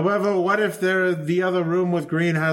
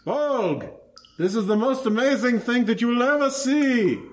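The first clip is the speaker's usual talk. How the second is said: excited; 9 semitones higher than usual. Acted. Hyping the machine.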